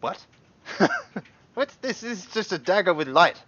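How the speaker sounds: high, loud disbelief; incredulous merchant. Acted. Shopkeeper catching out a customer's trick, loud and incredulous. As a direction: protest loudly, pitched right up. Merchant scoffs at the disguised dagger.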